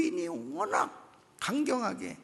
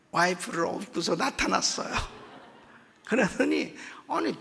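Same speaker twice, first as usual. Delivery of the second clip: usual speaking voice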